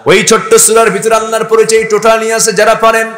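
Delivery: high, loud urging call; full-force preaching. Acted. Preacher urging listeners to recite aloud, high and loud. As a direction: call the crowd to join in.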